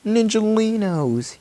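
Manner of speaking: high, slow happy call; high drawn-out call. Acted. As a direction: stretch the name out high and slow. Child voicing Night Ninja.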